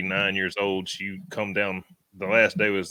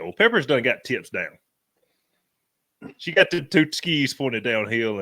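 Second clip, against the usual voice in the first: usual speaking voice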